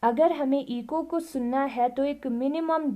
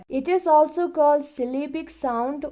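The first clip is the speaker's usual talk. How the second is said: reading the book aloud, very slowly and evenly; slow classroom read-aloud; slow textbook reading; 3 semitones higher, 5 dB louder, much slower than usual. Acted. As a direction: dictate it word by word to the class. Reads textbook line aloud.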